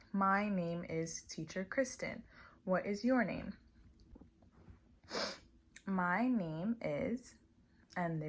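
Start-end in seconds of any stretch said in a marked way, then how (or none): 0.1-3.5 slow, clear self-introduction; slow teacher intro. Shown as a demonstration. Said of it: "So I would say". 5.9-7.4 very slow, drawn-out prompt. Shown as a demonstration. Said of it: "enforce them with my fingers".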